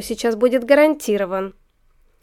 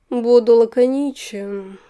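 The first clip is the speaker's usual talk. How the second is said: slow sultry purr; drawn out, seductive and slow; languid voice; 7 dB louder, slower than usual. Acted. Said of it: "томным голосом произнёс он".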